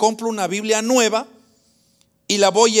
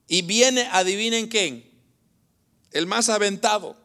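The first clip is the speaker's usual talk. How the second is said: usual speaking voice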